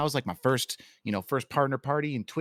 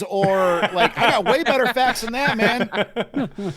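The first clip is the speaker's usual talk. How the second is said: much higher, laughing through the words; laughing boast to the friends; 11 semitones higher, 3 dB louder, much slower than usual. Real, not acted. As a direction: giggle out the brag.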